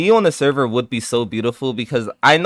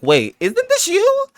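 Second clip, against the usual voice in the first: high-pitched, slow mock puzzlement; mock confrontation; slower than usual. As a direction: confront them in a much higher voice.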